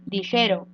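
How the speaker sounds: "Ligero" with GE stressed; stress on middle syllable. Shown as a demonstration. Teacher giving an example of a tonic syllable, "Ligero" stressed on GE. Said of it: "donde g sería la sílaba tónica".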